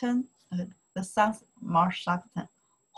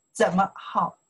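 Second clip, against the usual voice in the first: usual speaking voice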